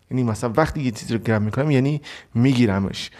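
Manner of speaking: usual speaking voice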